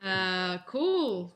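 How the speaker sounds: normal talking voice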